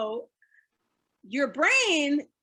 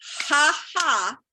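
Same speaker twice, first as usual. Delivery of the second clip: demo laugh; 2 semitones higher, 4 dB louder than usual. Shown as a demonstration. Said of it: "we're gonna do a ha ha".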